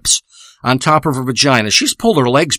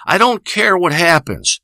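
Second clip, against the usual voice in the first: normal talking voice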